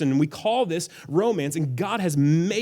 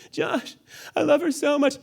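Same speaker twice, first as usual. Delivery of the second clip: much higher, pleading smitten man; lovesick plea; 10 semitones higher than usual. Retold or imitated. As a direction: whine it like a lovestruck guy. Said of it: "you're like".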